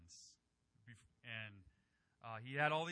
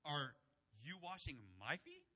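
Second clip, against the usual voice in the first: incredulous question; 4 dB quieter than usual. Shown as a demonstration. Preacher voices Peter questioning Jesus, incredulous. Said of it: "conveys incredulity".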